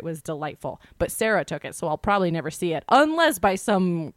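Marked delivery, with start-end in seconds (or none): none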